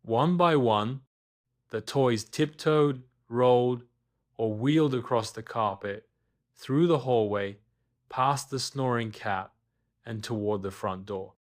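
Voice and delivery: ordinary delivery, nothing marked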